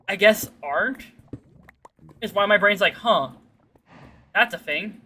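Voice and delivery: ordinary talk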